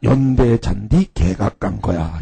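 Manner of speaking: low, slow deadpan verdict; mock-serious. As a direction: imitate a teacher gravely stating an absurd fact. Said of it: "이거를 굉장히 진지하게".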